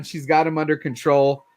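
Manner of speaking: ordinary talk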